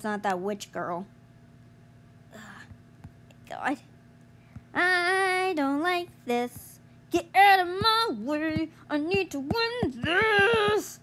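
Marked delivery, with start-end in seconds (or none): none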